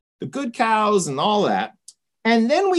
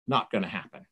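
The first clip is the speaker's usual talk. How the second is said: low, soft, final no; dismissive refusal; 12 semitones lower, 9 dB quieter, faster than usual. Retold or imitated. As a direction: shut it down quietly and low. Samuel refuses Saul's plea.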